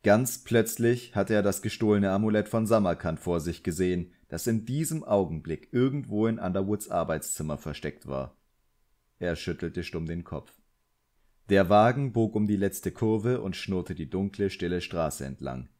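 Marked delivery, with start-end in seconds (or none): none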